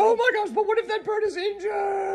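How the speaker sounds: high frantic worry; panicked high voice. Pretend. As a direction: panic over the dropped bird. Worried the dropped bird is hurt.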